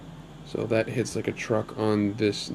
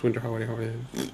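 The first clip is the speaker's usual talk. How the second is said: quick, softer rattled-off repeat; fast tongue twister; 6 dB quieter than usual. Shown as a demonstration. Vlogger trying a tricky enemy name three times fast, rapid. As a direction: rush through it under your breath like a tongue twister. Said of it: "Try to say that three times fast".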